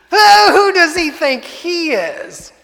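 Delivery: high, loud, slow sneering devil voice; mocking Satan. Satan mocks Jesus in hell, high, loud, slow sneering devil. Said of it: "Satan burst out laughing himself".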